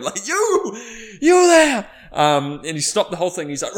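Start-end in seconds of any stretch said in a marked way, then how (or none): 0.3-1.9 high, loud, stretched-out call; imitated booming call-out, pitched way up. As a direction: holler at someone across a crowd. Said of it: "It was like Pink Floyd".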